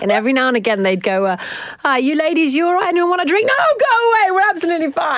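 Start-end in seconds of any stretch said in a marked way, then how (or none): 1.8-3.5 interrupting husbands. Retold or imitated. Acting out the fellas' interruption. 3.5-5.2 high-pitched retold brush-off; mock shooing. Women sending their fellas away mid-gossip, mock shooing.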